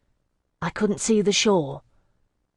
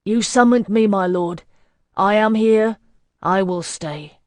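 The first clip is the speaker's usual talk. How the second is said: resolute declaration; 3 semitones higher, 6 dB louder than usual. Acted. Hyacinth declaring to the Master that he will stay, loud. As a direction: claim your fate out loud.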